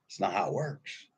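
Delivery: ordinary talk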